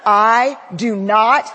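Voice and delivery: slow and loud, stunned; loud slow confused protest; panicked disbelief. Retold or imitated. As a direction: insist you don't understand, rattled. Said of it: "I said".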